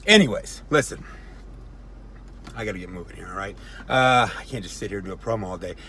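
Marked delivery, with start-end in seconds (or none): none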